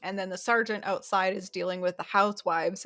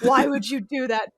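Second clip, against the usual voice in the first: loud, higher-pitched mock outrage; retold seething; 5 semitones higher, 8 dB louder than usual. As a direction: exclaim it as an imitation of seething disbelief. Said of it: "just sitting there seething like".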